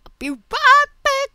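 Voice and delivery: loud, high-pitched silly sign-off. Pretend. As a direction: squawk it out playfully high. Silly sign-off, then repeated differently.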